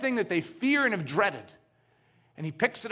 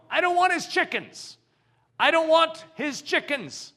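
much higher, insistent refusal; firm refusal; 10 semitones higher than usual. Acted. Gautama refuses the chickens before the chief, much higher. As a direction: repeat it higher and stubborn.

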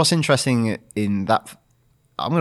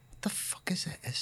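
whispered mock bewilderment; imitated baffled whisper; 7 semitones higher, 17 dB quieter than usual. Host voicing firemen who don't know Michael Myers, baffled whisper. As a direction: whisper it high, as a baffled onlooker.

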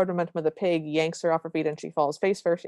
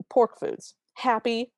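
mock-angry, pitched a little higher; mildly annoyed retelling; angry listing; 5 semitones higher, slower than usual. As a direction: toss out each item like a slammed package. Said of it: "she's angrily slamming down".

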